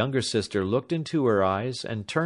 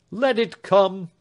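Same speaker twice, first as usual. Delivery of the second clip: resolute; 10 semitones higher, 6 dB louder, slower than usual. A narrator's reading. Alfred ready to go.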